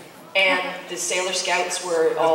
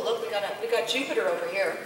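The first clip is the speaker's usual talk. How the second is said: usual speaking voice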